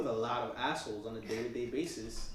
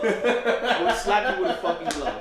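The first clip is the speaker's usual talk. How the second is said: laughing mock threat; 7 semitones higher, 10 dB louder than usual.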